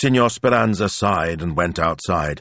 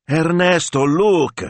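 high excited shout; 11 semitones higher, 5 dB louder, slower than usual. Acted. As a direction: call out loudly in excitement. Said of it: "shouted Ivano".

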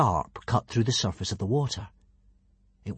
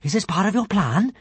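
higher-pitched hopeful question; 9 semitones higher, 4 dB louder than usual. A narrator's reading. Kamikaze asks Hiccup about his plan, hopefully. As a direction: ask as if wishing for a yes.

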